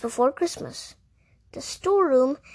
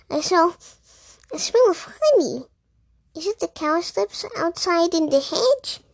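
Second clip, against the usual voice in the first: higher, sniffing suspicious mouse; 4 semitones higher than usual. A narrator's reading. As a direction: sniff around, suspicious and prim.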